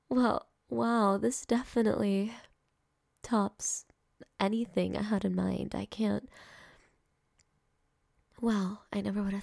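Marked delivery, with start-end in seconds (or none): none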